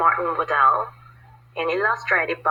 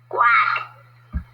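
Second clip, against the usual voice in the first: a higher quack; duck quack; 4 semitones higher than usual. A narrator's reading. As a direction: answer as the duck. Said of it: "The duck answered".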